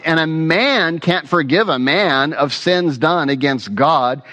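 ordinary talk, nothing marked

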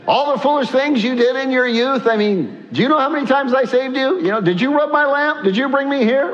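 high-pitched, rapid-fire mock grievance; exasperated guardian angel. Guardian angel to the person they kept saving, comic exasperation. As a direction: rattle off complaints as a fed-up angel. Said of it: "gonna see my guardian angel".

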